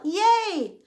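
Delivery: high-pitched little yay; excited cheer. Real, not acted. Speaker celebrating coming on board in a new salon, excited.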